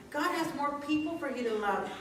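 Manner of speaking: usual speaking voice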